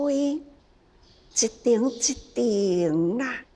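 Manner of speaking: normal talking voice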